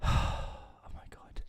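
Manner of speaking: breathy, slow whispered sigh; whispered despair. Real, not acted. Worn out after mocking the film, slow whisper.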